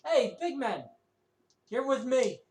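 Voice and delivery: rally the big man to follow you; pirate woman's commanding shout; brisk rallying call. Acted. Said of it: "slaps you on the elbow and says".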